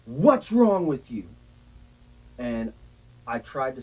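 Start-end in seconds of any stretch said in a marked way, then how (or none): 0.0-1.2 alarmed mom. Retold or imitated. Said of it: "she was like".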